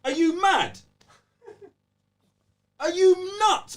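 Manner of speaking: high, loud outraged yell; disbelieving shout. Real, not acted. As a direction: yell it like you can't believe it. Outraged at the game's price.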